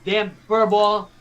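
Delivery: high, hammed-up Boston accent. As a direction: join in with a mock Boston voice, R's dropped. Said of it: "to do this Boston thing now".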